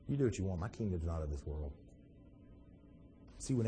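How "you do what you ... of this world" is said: low, soft, unbothered answer; talking real low. Acted. Jesus answering Pilate's kingdom boast, talking real low. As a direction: brush off the boast quietly. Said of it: "talking real low".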